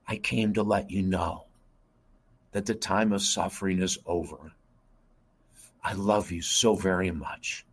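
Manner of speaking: put-on gentle, unhurried mother soothing her child, long pauses, fading to a whisper at the end; mother's comforting voice. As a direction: reassure tenderly, letting each phrase settle, whispering the final endearment. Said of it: "this is what she used to say when I was a child".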